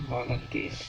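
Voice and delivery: VOLUNTEER softly with a voiced V. Shown as a demonstration. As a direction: model VOLUNTEER gently, buzzing V at the start. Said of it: "Pakainya V". (VOLUNTEER pelan dengan V bersuara)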